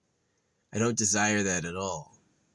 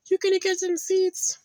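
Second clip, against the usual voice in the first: very high, squeaky pet-talk voice; 6 dB louder than usual. Real, not acted. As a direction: squeal it to a little animal, a bit louder. Cooing promise to begging squirrel.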